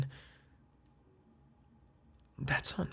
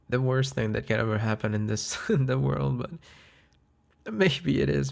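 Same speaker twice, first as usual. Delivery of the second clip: laughing exaggeration; 2 semitones higher, 6 dB louder than usual. Laughing about first laundry fight.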